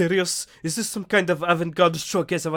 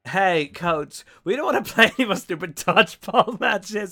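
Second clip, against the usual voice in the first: laughing through lines; 5 semitones higher, 6 dB louder than usual. Acted. Voiced Polly pleading to coach.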